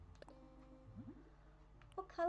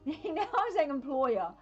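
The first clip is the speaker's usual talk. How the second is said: high, loud, laughing through the words; amused outburst breaking into laughter; 6 semitones higher, 11 dB louder, slower than usual. Real, not acted. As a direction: crack up while talking. Cracking up at own pronunciation.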